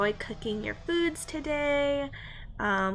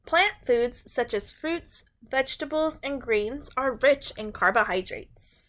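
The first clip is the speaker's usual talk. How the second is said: bright, clear storybook read-aloud; reading to children. A narrator's reading. Teacher reads a food book to her class, storybook voice.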